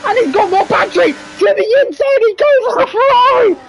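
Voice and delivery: excited motor racing play-by-play; live race commentary. Real, not acted. Commentator calling the battle for the lead, excited. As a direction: call the race with urgent excitement.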